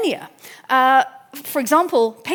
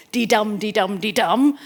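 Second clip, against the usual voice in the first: "dee-dum" with each "dum" stressed over its "dee"; iambic rhythm. Shown as a demonstration. Lecturer showing the familiar iamb, stressed on every "dum". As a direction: chant "dee-dum" as a steady weak-strong beat. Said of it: "so the, the iamb".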